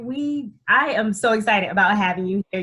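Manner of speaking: usual speaking voice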